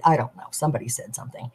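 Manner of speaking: quick, fast whisper thrown away; hushed, rapid whisper; whispered aside. Real, not acted. Muttered aside, voiced without tone.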